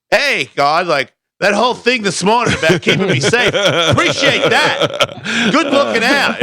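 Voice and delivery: high, laughing mock gratitude to God; sarcastic thanks. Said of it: "I wasn't very happy about it".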